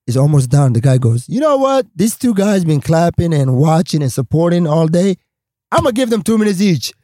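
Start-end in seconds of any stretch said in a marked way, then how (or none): 1.3-6.9 high, expansive announcing voice; mock generous host. Host telling the room he'll give them spots, high and generous.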